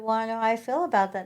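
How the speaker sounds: a hint of a miffed husband; husband's reply. The husband retorts about the duplex, quick and loud. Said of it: "And he said".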